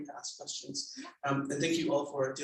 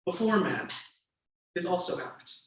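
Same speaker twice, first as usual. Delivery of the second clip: louder, measured reading aloud; memoir reading; 3 semitones higher, 7 dB louder than usual. A narrator's reading. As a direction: read the passage to the audience with weight. Author reads memoir aloud.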